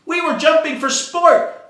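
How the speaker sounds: high, loud excited fish; high shouted explanation; shouting. Acted. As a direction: shout it out in a fluster. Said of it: "the fish started to shout".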